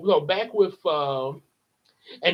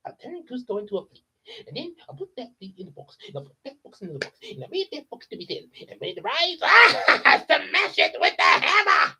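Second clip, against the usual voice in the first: Yzma; 6 semitones higher, faster than usual. Shown as a demonstration. [name] as Yzma plotting aloud, building to a shriek. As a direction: rattle off the plot then shriek the ending. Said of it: "I can do that voice".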